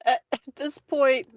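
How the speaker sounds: ordinary talk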